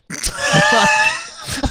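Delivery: a sudden very high shriek of laughter. Real, not acted. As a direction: let out a piercing squeal of laughter. Laughing at own joke.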